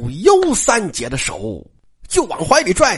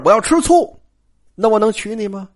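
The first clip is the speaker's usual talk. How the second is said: ordinary delivery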